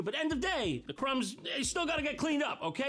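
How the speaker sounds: a bossy manager nagging. Acted. Boss voice scolding janitor.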